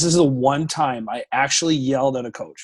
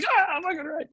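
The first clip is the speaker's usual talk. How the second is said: much higher, softer hyped-up cheer; mock cheer of the boys; 6 dB quieter than usual. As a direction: squeak it out like the boys backing him. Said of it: "All the boys like".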